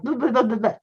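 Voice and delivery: rapid garbled babble; quick bubbling string of syllables; imitating fast talk. Shown as a demonstration. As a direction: rattle it off as meaningless noise. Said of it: "if you talk fast, it may sound like".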